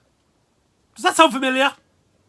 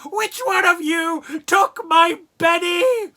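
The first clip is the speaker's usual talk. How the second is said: much higher, louder, slow mock demand; hammed-up grieving Velma; 10 semitones higher, 5 dB louder, slower than usual. Velma confronting the team, high, slow and hammed-up. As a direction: draw it out like a tragic heroine. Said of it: "she comes up and she's like".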